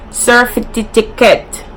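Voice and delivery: certificate said "surfy ticket", slowly; Jamaican surfy ticket. Shown as a demonstration. YouTuber repeating the Jamaican way. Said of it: "even say surfy ticket".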